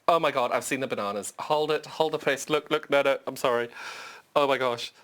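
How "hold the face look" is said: usual speaking voice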